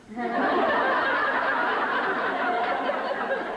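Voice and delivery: long loud high laugh; high burst of laughter. Real, not acted. Laughs at her nails line.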